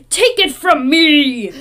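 loud, drawn-out mock dramatic line reading; exaggerated, slow, loud impression of an actor; mimicked line delivery. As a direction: imitate an actor's oddly forceful delivery. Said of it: "Why does he say it like that?".

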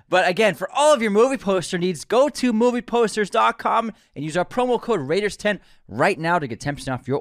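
loud, high sales pitch; punched-up ad read. Acted. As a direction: belt out the sponsor plug. Resumes the sponsor spot loudly.

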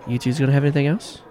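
slight Irish lilt, quick question; Irish bartender. Acted. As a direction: take the order with a hint of Irish. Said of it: "this strong-built Irishman".

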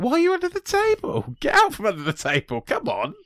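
shooting way up, loud and incredulous at first; exasperated plea. Retold or imitated. Retelling her reaction to the film, mock exasperated. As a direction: urge them out from under the table, exasperated. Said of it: "I was like".

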